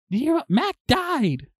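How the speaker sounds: very high, urgent mimicked voice; imitating frantic sister. Sister telling the sibling of a death, mimicked high and urgent. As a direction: blurt the news out high, as the sister did. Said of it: "That's how she's talking to me".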